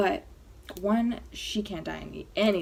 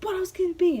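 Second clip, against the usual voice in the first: imitating mom's high, loud disbelief; mock exasperated outburst, pitched way up; freaking-out mom; 11 semitones higher, 6 dB louder than usual. Mom insisting her daughter must be pregnant, freaking out. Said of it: "she's, like, freaking out".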